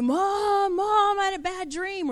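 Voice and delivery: a child waking from a bad dream; scared child. Child calling out at night.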